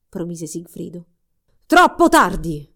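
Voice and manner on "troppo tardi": slow, much louder booming cry; booming roar. Acted. Sorcerer bursting in on the lovers, slow booming roar. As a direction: thunder it from the darkness. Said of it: "rombò una voce dall'oscurità".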